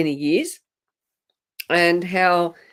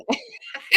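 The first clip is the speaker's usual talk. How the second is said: breathy laugh; 8 semitones higher, 7 dB quieter than usual. Real, not acted. Laughs at the frozen video.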